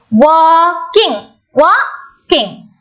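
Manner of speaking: "walking" slowly broken as wal, king; split into syllables. Shown as a demonstration. Teacher showing the two sounds, "walking" split syllable by syllable. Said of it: "We are splitting it into two".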